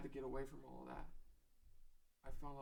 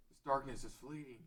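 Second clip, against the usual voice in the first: usual speaking voice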